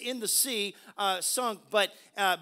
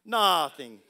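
low-pitched Italian accent; mock Italian accent, dropped low; 6 semitones lower than usual. Shown as a demonstration. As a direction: put on a playful Italian accent. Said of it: "Say it with an Italian se-, accent".

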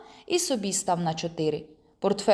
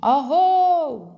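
high, loud drawn-out holler; calling back from afar; 8 semitones higher, 7 dB louder than usual. A narrator's reading. Friend answering from far off, calling loudly. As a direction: holler back over a long distance. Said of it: "здалеку обзивається".

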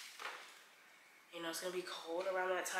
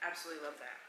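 usual speaking voice

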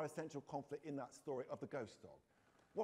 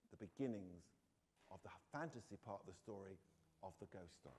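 ordinary delivery, nothing marked

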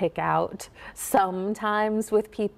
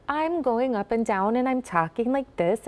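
pitched up, singsong Minnesotan lilt; bouncy Scandinavian-influenced lilt; 4 semitones higher than usual. Shown as a demonstration. Coach showing Scandinavian influence, bouncy Minnesota accent. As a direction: bounce the tune up and down, Minnesota style. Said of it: "the bounciness in the accent".

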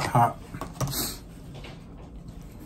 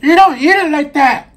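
high, loud scolding voice; squeaky disapproving diner; mock scolding person; 14 semitones higher, 9 dB louder than usual. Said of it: "that one person would be like".

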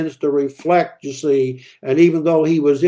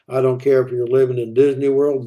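ordinary talk, nothing marked

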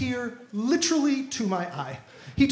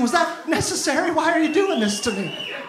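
pleading to the ceiling; 5 semitones higher than usual. Pretend. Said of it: "Start talking to the ceiling".

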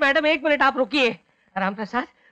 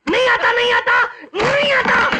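shouting high and loud that he is not angry; furious denial; 9 semitones higher, 3 dB louder than usual. Acted. Calm man screams at the woman that he never gets angry. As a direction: yell it, voice cracking with rage.

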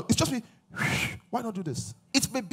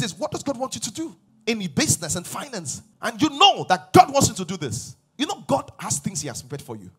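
normal talking voice

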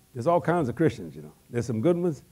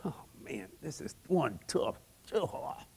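much softer, slow, weary; anguished murmur; 11 dB quieter, slower than usual. Retold or imitated. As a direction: breathe it out under the weight. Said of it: "you hear him in the Garden".

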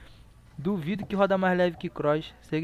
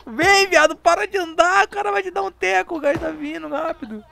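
much higher, louder frantic calling; panicked urging; 13 semitones higher, 9 dB louder than usual. Real, not acted. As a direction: urge them on in a panic. Urging teammate before gas.

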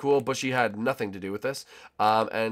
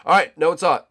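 raised, higher voice; loud all-clear; 6 semitones higher, 11 dB louder than usual. Pretend. Gamer declaring nobody saw his fall, loud all-clear. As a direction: announce it openly, loud and brisk. Same words, now out loud.

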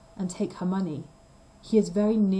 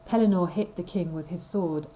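plain reading voice

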